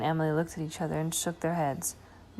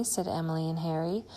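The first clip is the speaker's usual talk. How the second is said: ordinary delivery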